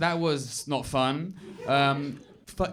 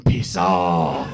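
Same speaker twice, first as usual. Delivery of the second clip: loud, low mock-angry dad shout; hammed-up deep dismissal; knee-jerk dismissal; 11 semitones lower, 6 dB louder than usual. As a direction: bellow it like a dad's reflex. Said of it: "his knee-jerk reaction to everything is".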